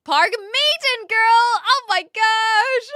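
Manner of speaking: high, loud hyped shout; excited shout. Real, not acted. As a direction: blurt it out thrilled. Reading chat name excitedly.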